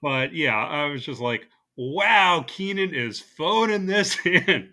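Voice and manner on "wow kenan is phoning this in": louder, higher, drawn-out wow; mock amazement. Host retelling reaction to a phoned-in impression, mock amazed. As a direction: stretch it out in amused disbelief.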